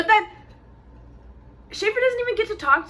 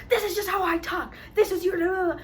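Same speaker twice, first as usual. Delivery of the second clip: mocking Kennedy; 7 semitones higher than usual. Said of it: "Kennedy's all like".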